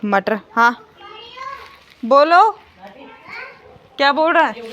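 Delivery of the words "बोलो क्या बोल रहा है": loud, high call across the field; shouting over to her son. Real, not acted.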